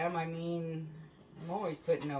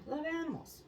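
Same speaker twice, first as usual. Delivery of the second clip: mock high; 11 semitones higher, 4 dB quieter than usual. Sarcastic aside.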